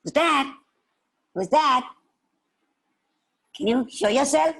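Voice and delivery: put-on high, sharp child's challenge; startled little girl. Little girl hearing another noise, startled. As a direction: call out to the hidden stranger.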